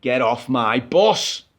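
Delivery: low, slow, loud order off the bus; low gruff driver. Retold or imitated. Driver ordering the coughing passenger off the bus, low and slow. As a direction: drag it out low and firm like the driver. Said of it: "he says again".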